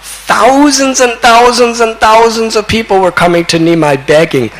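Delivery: high ringing preacher's delivery, easing down; impassioned storytelling. Acted. Preacher telling of crowds flocking to Nimai, impassioned storytelling. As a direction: launch high on the huge crowd, then gradually descend.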